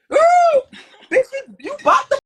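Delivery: high, loud squeal of delight; squealing hype. Real, not acted. Guest recalling the fight, squealing with glee. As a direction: scream it like you can't believe it.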